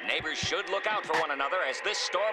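news announcer. Acted. TV news bulletin.

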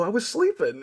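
high-pitched protest; lightly aggrieved retort; mock indignant. Patient to dentist after waking, mock indignant. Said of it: "You look so angry".

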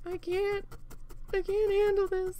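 high-pitched, rising distress; overwhelmed. Retold or imitated. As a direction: re-enact being at breaking point. Retold near breakdown at work.

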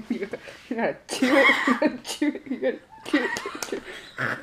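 laughing harder and harder. Real, not acted. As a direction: giggle through the words. Teasing him to chew, laughing.

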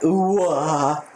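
very high hooting creature cry; playful imitation of a creature cry; mock Pokemon cry. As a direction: echo the creature's call up high. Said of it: "I love that".

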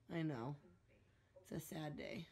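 hushed mock sadness; whispering. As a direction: whisper it like a mourner. Mock mourning to dog.

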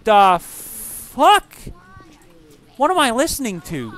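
drawn-out rising the fuck; baffled by a strange voice; startled disbelief. Real, not acted. Hears strange voice.

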